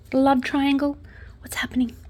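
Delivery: hushed, whispered mock gossip; conspiratorial whisper. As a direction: whisper it like juicy speculation. Mock gossip about the incident.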